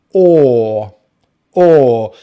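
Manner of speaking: loud, held "or" with rounded lips; sustained round "or"; long vowel demo. Shown as a demonstration. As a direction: hold "or" long, no R. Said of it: "is a long vowel sound".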